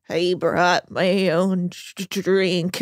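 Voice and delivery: low, dragged-out slurred stammer; mock drunk guest. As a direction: stumble slowly over it like a sloshed guest. Mocking a drunk guest's reply.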